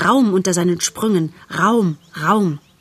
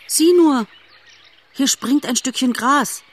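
high, eager cry of wonder; excited fawn; 6 semitones higher than usual. A narrator's reading. Fawn shows mother a jumping grasshopper, high and eager. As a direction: point it out, thrilled.

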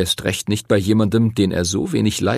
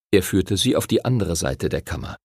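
ordinary delivery, nothing marked

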